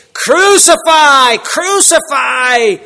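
loud, slow, high crowd chant of Crucify; crowd's cry. A narrator's reading. Preacher voicing the mob crying out for crucifixion, loud slow chant. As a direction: shout it out like a baying mob. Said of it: "they cried out, saying".

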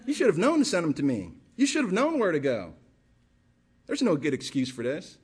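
ordinary talk

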